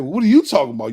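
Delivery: YOU punched loud, thrown back; stress on YOU. Retold or imitated. Ex-convict answering baffled churchgoers, loud stress on YOU.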